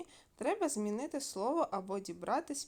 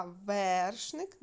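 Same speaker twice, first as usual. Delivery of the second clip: вершник loud and drawn out on ВЕР; slow, loud ВЕРшник, first vowel held; stress on first syllable; 4 dB louder than usual. Shown as a demonstration. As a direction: punch and stretch the е in вершник. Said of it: "Оце наше перевірне слово".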